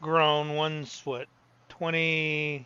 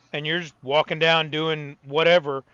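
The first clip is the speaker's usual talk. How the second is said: ordinary talk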